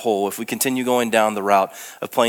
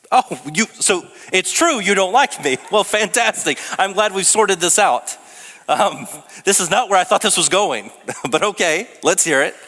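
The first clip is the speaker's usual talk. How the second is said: high, mock-cheerful sarcasm, well then; sarcastic, high-pitched mock gratitude; 10 semitones higher, 3 dB louder than usual. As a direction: put on a bright fake delight, much higher. Retelling his own reaction, sarcastic.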